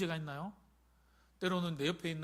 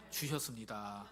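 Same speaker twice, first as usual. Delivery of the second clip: ordinary talk, nothing marked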